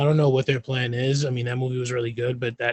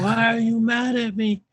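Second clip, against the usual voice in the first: higher, puzzled dad voice; Red Guardian impression; 9 semitones higher, 4 dB louder than usual. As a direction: play him clueless, hurt that they're upset. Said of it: "he was like".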